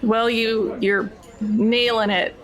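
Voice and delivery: normal talking voice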